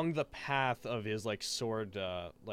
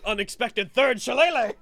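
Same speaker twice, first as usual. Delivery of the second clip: excited announcement; 9 semitones higher, 11 dB louder than usual. Real, not acted. GM announces surprise move.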